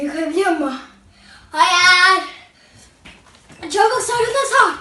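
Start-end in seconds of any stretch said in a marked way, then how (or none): 1.5-4.7 high, loud, desperate; urgent shout. Real, not acted. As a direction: shout for help, mouth on fire. Mouth burning, rushes host. (acil, yüksek sesle bağırma)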